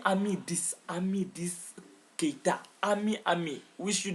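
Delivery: nom soufflé à voix basse; chuchoté. Real, not acted. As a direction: murmure le nom comme une confidence. Murmure le nom, vœux d'anniversaire. (chuchoté tout bas)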